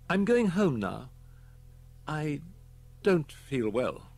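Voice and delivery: lightly voiced boy feeling ill; unwell boy. Boy telling the secretary he feels unwell, a touch poorly. Edward tells Platt he's ill.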